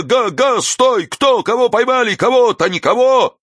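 high, agitated hollering; high-pitched excited uproar; crowd clamour. A narrator's reading. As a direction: shout questions from a confused crowd. Agitated crowd shouting questions.

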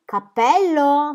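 "cappello!" stretched slow, loud and high; "cappello!" yelled long and loud; shrieked "cappello!". Acted. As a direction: drag out "cappello!" as a shout. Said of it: "strillò".

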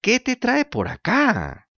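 high, slow, sugary fox greeting; sly fox voice. Acted. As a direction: purr it like a scheming fox. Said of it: "gritó la zorra".

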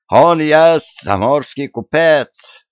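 put-on high, slow German-accented Russian. A narrator's reading. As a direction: blurt it out slowly with a German accent. German Willy butts in, broken Russian. (высоко и медленно, с немецким акцентом)

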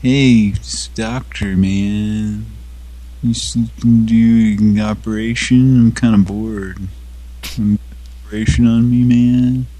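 lightly voiced casual dude asking for surgery; a hint of a lazy bored guy; bored patient voice. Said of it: "they're just like".

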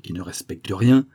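ordinary delivery, nothing marked

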